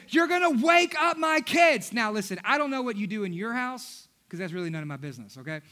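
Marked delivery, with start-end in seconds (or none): 0.1-1.9 high, louder irritated complaint; a grumpy dad protecting the nap; annoyed father. Retold or imitated. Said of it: "he says".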